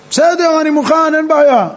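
high-pitched, eager agreement; acted ready acceptance. Opponent accepting the prophet's challenge, eager and high-pitched. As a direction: take up the dare readily, pitched high.

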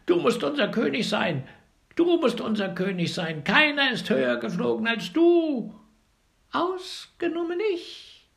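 high, loud little bird crying out; shrill bird cry. A narrator's reading. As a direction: shout it up high like a tiny bird. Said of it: "schrie der kleine Kerl".

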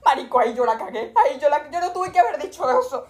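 loud, high outburst at her own mistake; loud self-scolding. Real, not acted. Speaker berates herself to viewers, loud and high. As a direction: she yells at herself for the blunder.

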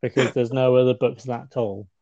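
loud, laughing through the words; real amusement bubbling over. Real, not acted. Scene: laughing at the absurdly long book spine, loudly.

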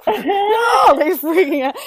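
loud, high exclamation; exclaimed. Retold or imitated. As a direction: blurt it out loud. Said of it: "I'm like".

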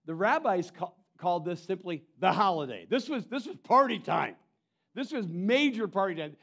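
loud, high, driving sermon delivery; full-force preaching. Acted. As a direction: preach it with rising fervour. Fired-up preaching on the feast.

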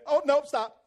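hammed-up cold feet; mock last-second balking. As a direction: back off the edge in mock alarm. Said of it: "you kinda went and you went".

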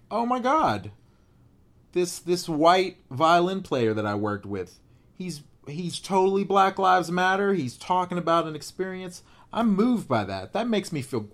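high, loud, amazed exclamation; excited. Retold or imitated. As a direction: gush with sudden delight. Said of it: "I'm like".

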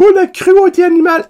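high, loud mock-outraged gasp; parodying indignant people, hammed up; mock outrage. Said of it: "Le monde va comme". (indignation exagérée, voix aiguë)

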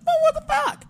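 high, loud squeal of disbelief; shocked disbelief. Retold or imitated. Watching a commercial cut during the fight, a shocked high squeal. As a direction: shriek it like you can't believe the TV. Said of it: "I'm like".

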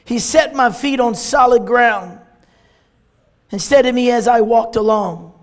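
loud, declaimed Bible verse; strong pulpit reading with pauses between phrases; preached scripture reading. Real, not acted. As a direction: proclaim the verse phrase by phrase with force.

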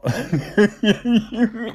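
high incredulous laugh. Real, not acted. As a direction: burst out laughing in disbelief. Laughs at absurd claim.

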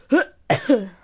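loud coughing fit. Real, not acted. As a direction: cough hard a few times. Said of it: "ich bin ein bisschen am Husten".

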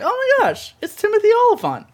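high, fast, star-struck squeal; excited squeal. Retold or imitated. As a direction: gush like you just spotted a celebrity. Retold star-struck reaction.

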